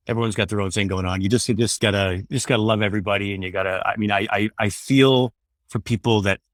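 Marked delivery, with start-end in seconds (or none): none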